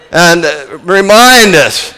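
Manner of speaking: usual speaking voice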